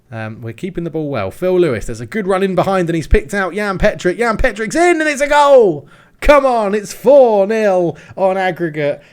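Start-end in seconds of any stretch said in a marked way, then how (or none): 1.3-4.2 excited commentary. Real, not acted. Goal chance building in commentary. 4.2-6.7 screaming goal celebration, high and loud; goal yell. Real, not acted. His team scores a goal. 6.7-9.0 slow, loud, triumphant; excited. Real, not acted. Celebrating the goal.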